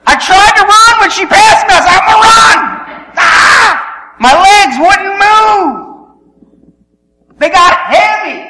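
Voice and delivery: high, loud, straining cry; desperate shout. Acted. Runner trying to keep up when passed, desperate shouting. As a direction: yell as if forcing legs to move. Desperate failed attempt to run.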